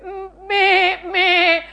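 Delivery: needy sheep bleats, then pleads; bleat, then whine like a sheep; complaining little sheep. Acted. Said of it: "Sheep bleat".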